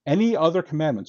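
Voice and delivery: any punched high, rest of the phrase fast; stress on any. Shown as a demonstration. Host stressing a word in verse nine, punched any. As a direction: hit the word any hard and rush the rest. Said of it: "and I stress this word".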